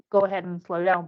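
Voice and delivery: usual speaking voice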